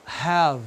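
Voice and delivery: "have" ending on a bare v; silent final E. Shown as a demonstration. Teacher modelling the example word, silent E in "have". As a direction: close "have" on the v, no ee. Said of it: "não se pronuncia no final".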